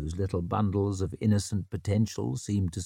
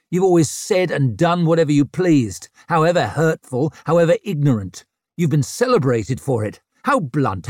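angry; 4 semitones higher, 6 dB louder than usual. Acted. Bitter rant at old friend.

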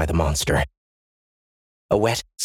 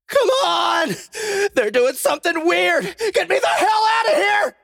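panicked shout. Acted. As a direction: scream it through the door. Still banging on the doors.

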